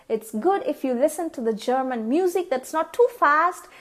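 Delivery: ordinary talk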